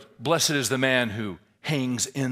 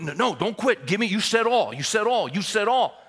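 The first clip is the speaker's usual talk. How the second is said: high, loud, pounding demands; insistent yelling coach; 10 semitones higher, 8 dB louder than usual. Retold or imitated. Coach to kid who wants to quit, insistent yelling. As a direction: refuse to let him quit, hollering.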